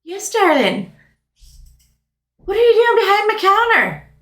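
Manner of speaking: high, sweet-toned, faintly mocking; sugary shopkeeper. Shop owner sweetly questioning the intruder behind her counter. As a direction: greet the trespasser with syrupy sweetness.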